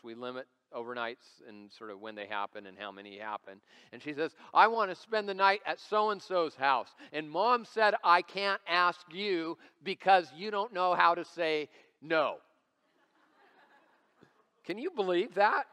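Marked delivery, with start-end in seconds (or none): 4.5-12.4 loud, raised-pitch imitation of a child; insistent daughter. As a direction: recount it as an emphatic child, loudly spelling it out. Daughter blurting out her request to dad.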